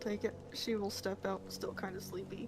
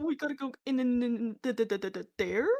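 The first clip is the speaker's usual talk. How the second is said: mock nervous stammer. Player facing the ominous mansion, mock stammer. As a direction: stutter on "in" and "da" before "there". Stuttering echo of the nervous question.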